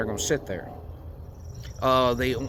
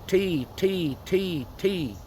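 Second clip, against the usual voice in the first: short, higher T sounds, each cut off at the end; 6 semitones higher, much slower than usual. Shown as a demonstration. Hunter showing the call rhythm, short higher T sounds. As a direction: tap out quick T's, no drawing out. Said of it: "I'm just going T".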